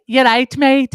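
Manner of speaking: high, loud English greeting; chirpy English accent. Retold or imitated. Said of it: "I'd be like".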